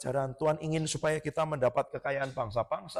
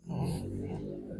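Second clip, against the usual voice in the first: ordinary delivery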